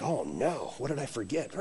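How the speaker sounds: very low, hushed muttering; worried inner thought. Retold or imitated. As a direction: think aloud under your breath, acting worried. Retells his worried inner thought.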